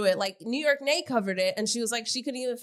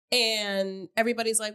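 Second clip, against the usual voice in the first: ordinary talk, nothing marked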